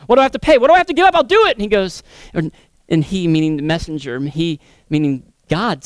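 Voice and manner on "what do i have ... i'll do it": high, loud, eager begging; desperate plea. Acted. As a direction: beg to be told the price.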